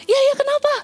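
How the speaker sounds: high, alarmed questioning; acted high-pitched alarm; imitated startled husband. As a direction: answer a scream with startled urgency. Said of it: "suami saya kaget banget".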